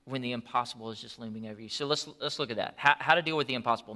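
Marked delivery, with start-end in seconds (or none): none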